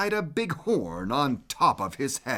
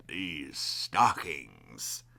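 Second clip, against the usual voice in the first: much lower, softer, slow sly tone; grinning low; 13 semitones lower, 10 dB quieter, slower than usual. Acted. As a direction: grin through it quietly. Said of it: "he grinned".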